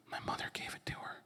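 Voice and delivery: quick, furtive imitated whisper; secretive whisper. Dad explaining the hidden bracelet to son, secretive whisper. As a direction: whisper it fast, for one listener only.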